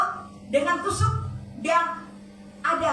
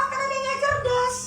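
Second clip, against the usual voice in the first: ordinary delivery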